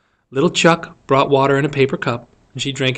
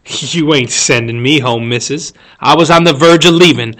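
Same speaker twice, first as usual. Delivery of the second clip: Burris, rudely defiant; 7 dB louder than usual. Acted. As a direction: talk back rudely to teacher. Burris defies the teacher.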